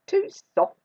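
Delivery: high, emphatic story-voice complaint; fussy complaint. A narrator's reading. Goldilocks rejecting the middle chair, fussy. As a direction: voice a picky little girl.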